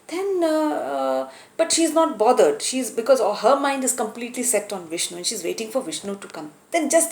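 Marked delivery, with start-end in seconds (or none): none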